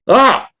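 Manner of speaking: short, high-pitched cry; shocked yelp. Retold or imitated. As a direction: let out a sudden high yelp. Retold shocked reaction to bad news.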